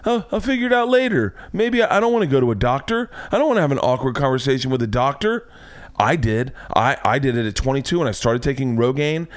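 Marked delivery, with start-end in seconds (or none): 0.1-5.5 higher, offhand voice of a procrastinator; lightly voiced careless guy; lazy dismissive guy. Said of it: "and he thought".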